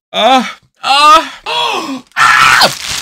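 a high, loud squealing scream; excited shriek. Real, not acted. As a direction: shriek with delight.

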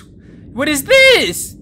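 high, loud and stretched out slowly; stunned outcry. Real, not acted. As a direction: drag out each word in disbelief. Shocked by anime scene.

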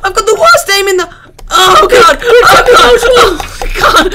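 high, loud frightened wail; panicked cry. Real, not acted. Player panics while shooting the monster, frightened wail. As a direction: cry out in real terror.

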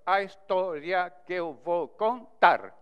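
slow, measured count of each syllable; syllable-by-syllable scansion. Shown as a demonstration. Teacher showing students the seventh-syllable accent, slow syllable-by-syllable scansion. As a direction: chant it out syllable by syllable, landing on the last. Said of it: "Por exemplo ... sílaba acentuada".

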